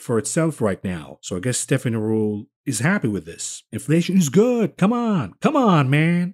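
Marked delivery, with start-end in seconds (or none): 3.7-6.3 sarcastic mockery. Sarcastic mimicry.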